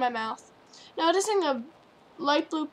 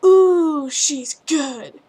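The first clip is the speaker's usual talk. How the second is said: excited; 10 dB louder, much slower than usual. Acted. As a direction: cry it out in delight. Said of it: "the pink pony cried out".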